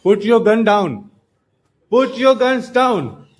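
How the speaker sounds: stern scolding. Real, not acted. Stopping nephews' toy-gun fight.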